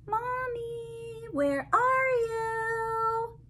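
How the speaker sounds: voicing a lost little fish. Lost fish calling for mommy.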